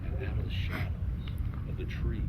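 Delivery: low hushed ghost-story voice; hushed spooky telling. A narrator's reading. Narrator hushing a scary campfire tale, barely voiced. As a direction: draw listeners in with a creepy hush.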